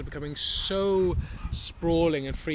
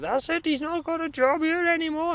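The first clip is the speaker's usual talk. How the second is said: high, louder mock manager voice; imitating manager Claire; 4 dB louder than usual. [name] mimics his manager declaring he has no job, high mock voice. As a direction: huff it like the odd boss. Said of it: "apparently, she said".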